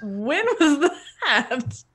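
slow words through a giggle; barely holding in laughter; laughing. Real, not acted. Player cracking up in character.

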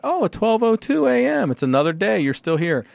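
high, feigned surprise settling down; mock ticketing cop. Cop finding car still there after midnight, mock surprise. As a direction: play the cop pouncing on a second chance.

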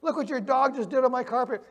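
high, shocked cry about the carpet; acting out horror at the ruined carpet; mortified gasp. Homeowner to friend whose poodle soiled carpet, mock horrified. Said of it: "And you're mortified".

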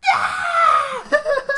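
high laughing scream; mock scream. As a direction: shriek through a laugh.

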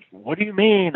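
mild mock shout of confusion; yell across the arena. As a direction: call it out from the far end of the empty arena. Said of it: "I yell, you know".

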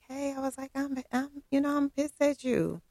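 high, halting complaint to a friend; high-pitched retold confrontation. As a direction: act out a high-voiced admission of annoyance. Retold phone confrontation, own part.

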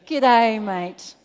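booming Australian "G'day," with a falling "mate."; Australian greeting. Shown as a demonstration. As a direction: call out "G'day, mate" in broad Australian to lead the crowd. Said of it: "Do you all know how to speak Australian?".

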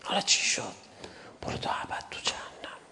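hushed, whispered condemnation; Imam's words to Zubair, whispered; bitter whisper. Acted. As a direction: whisper the sentence like a verdict. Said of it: "از جانب امیرالمومنین یه حرفی به زبیر بزنم".

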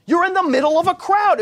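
high, loud, disbelieving outburst; exasperated, as if the question is absurd; incredulous disciples. Retold or imitated. Disciples answer Jesus in loud, high disbelief. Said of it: "the disciples said".

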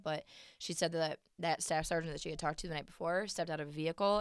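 ordinary talk

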